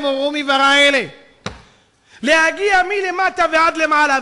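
high, loud impassioned preaching. Real, not acted. Preacher tells congregation all creation cries out to God, preaching loudly. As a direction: proclaim it at full force.

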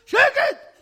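very high, loud yell; husband frantically shouting down his wife; shouted hush. Acted. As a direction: scream for silence.

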